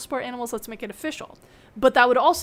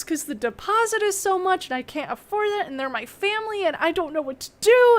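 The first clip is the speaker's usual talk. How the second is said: retold sobbing; 7 semitones higher than usual. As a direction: sob it out, pleading, pitched up. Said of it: "I immediately start sobbing".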